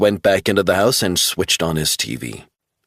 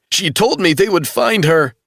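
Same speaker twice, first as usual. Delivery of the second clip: much higher, tearful and rushed at first; crying; 11 semitones higher, 3 dB louder than usual. A narrator's reading. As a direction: weep through it, barely holding on. Travell grieving.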